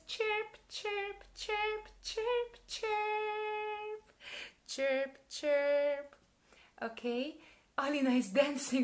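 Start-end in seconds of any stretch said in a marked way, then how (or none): 0.1-6.2 high sung chirping; bird chirps. Pretend. Teacher chirps like a bird for toddlers. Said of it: "And she says".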